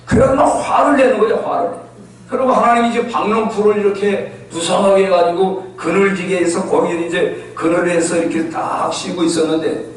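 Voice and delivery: ordinary talk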